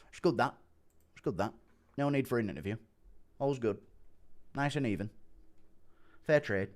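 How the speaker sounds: soft, terse approvals in a mimicked voice; quiet impersonation with short spaced phrases; Andy Aspinall impression. Streamer settling things as the fighter, softly impersonated. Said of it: "has heard my Andy Aspinall impression".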